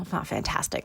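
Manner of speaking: quiet unvoiced aside; whispered correction. Real, not acted. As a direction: take it back under your breath.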